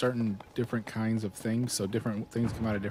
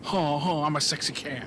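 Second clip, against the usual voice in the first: lightly imitated movie one-liner, pitched up; Nicolas Cage; 5 semitones higher, 3 dB louder than usual. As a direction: quote it as a hammed-up catchphrase. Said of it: "one line where he's like".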